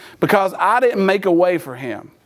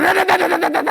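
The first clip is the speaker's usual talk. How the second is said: high, rapid stutter of a cranking car; imitated starter grinding; 8 semitones higher, much faster than usual. Son forgot to release the key, high grinding noise. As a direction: rattle it out like an ignition held too long. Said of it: "And it's going".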